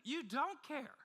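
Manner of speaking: high, loud, anguished reproach; hurt, pleading outrage at a sleeping teacher; desperate accusation. Retold or imitated. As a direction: cry it out like men about to drown. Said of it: "they're saying".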